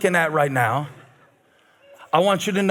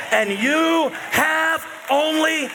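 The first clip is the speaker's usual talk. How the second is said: slow, stretched, higher swell; preaching build; 7 semitones higher, slower than usual. Acted. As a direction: draw it out toward the climax.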